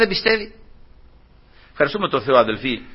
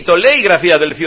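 ordinary delivery

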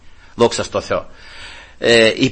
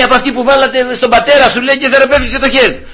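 eager explanation; 10 semitones higher, 4 dB louder than usual. Retold or imitated.

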